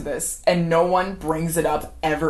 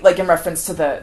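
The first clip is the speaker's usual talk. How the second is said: ordinary delivery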